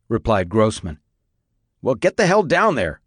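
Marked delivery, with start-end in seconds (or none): 1.8-3.0 higher-pitched, commanding order; raised-pitch command; sharp order. A narrator's reading. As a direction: order them to get moving. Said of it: "Richardson ordered".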